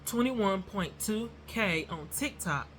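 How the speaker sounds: "Twenty-one point two K" slow and deliberate. Shown as a demonstration. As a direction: spell the number out slowly. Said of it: "I said it too fast".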